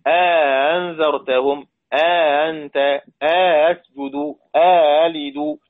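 أأنذرتهم slow, with a long vowel added between the hamzas and the second hamza softened; drawn-out Quranic recitation, an alif of madd slipped into أأنت and أسجد; recited with tasheel and idkhal. Shown as a demonstration. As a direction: read very slowly, lengthening each vowel of أسجد لمن خلقت. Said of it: "ومثله أبو عمرو تمامًا بتمام".

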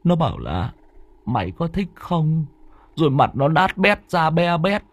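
high, breathless frightened voice; terrified young man telling his brother the ghost story. Acted. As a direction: tell the ghost story shaking with fear. Mạnh retells ghost in terror.

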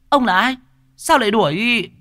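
high falsetto, suspicious questioning; alarmed woman. A narrator's reading. Woman to a stranger chasing a child, alarmed questioning.

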